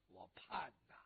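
a breathless whispered repeat of 我盼呐; longing whisper. Acted. Widow recalling years of waiting, whispering.

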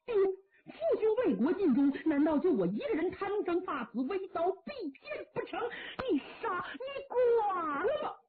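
high, breaking, slowing to a cry on the last words; sobbing challenge; weeping defiance. Acted. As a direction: offer up his neck in tears. Son defies father, offers death.

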